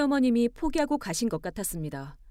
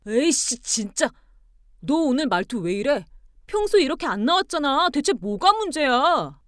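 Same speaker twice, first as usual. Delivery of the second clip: loud outburst, climbing higher; frustrated anger; 5 semitones higher, 6 dB louder than usual. Acted. Husband demanding what wife's problem is, frustrated. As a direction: lose patience and demand loudly.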